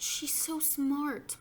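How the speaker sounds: slow, high gush of praise; lightly voiced doting mother. A narrator's reading. Mother's admiring praise, voiced by narrator.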